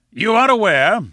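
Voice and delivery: loud, high "You are aware"; emphatic assertion. Acted. Lecturer asserts, then corrects himself.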